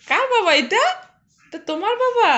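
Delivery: high cooing pet-talk questions. Real, not acted. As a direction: coo up high at the little one.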